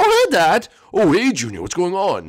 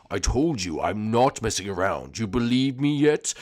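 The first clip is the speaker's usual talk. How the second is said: much lower, slow, menacing villain drawl; low sinister villain; 3 semitones lower, slower than usual. Acted. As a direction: purr it out like a creepy mastermind.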